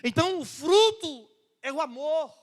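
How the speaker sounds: high, loud preaching shout on fruto; preaching at full force. Real, not acted. Preacher proclaiming to the church, loud and high.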